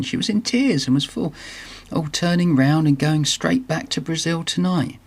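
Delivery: a hint of a flustered doctor; storybook character voice. Character's upset report in story.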